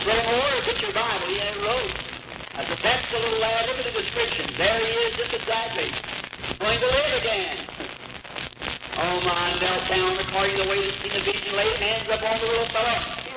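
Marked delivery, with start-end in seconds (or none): 0.0-7.6 high, ringing, eager exclamations; excited proclamation. Retold or imitated. Preacher to Brother Moore over the boy, excited and much higher. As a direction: announce the fulfilled vision with rising excitement.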